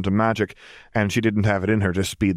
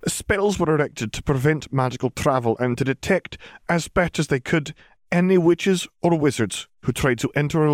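higher-pitched formal witch; McGonagall, explaining; 6 semitones higher than usual. A narrator's reading. Witch explaining the closed border, sober higher voice.

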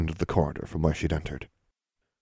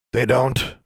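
gravelly male voice; 6 semitones higher, 3 dB louder than usual. Acted. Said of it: "said a gravelly male voice".

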